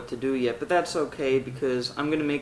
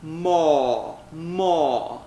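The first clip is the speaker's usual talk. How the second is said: vocal exercise; much slower than usual. Shown as a demonstration. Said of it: "And say".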